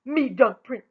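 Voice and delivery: loud whooping boast. A narrator's reading. Rella challenging the prince, loud whoop. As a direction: whoop it out while pounding your chest. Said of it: "pounding her chest and whooping".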